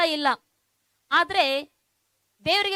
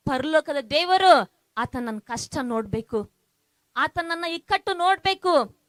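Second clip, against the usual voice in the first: building preaching; 3 semitones higher, 3 dB louder than usual. Real, not acted. Pastor voicing the congregation's prayer, building preaching. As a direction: voice their longing, building with each line. Preacher voices the listeners' longing.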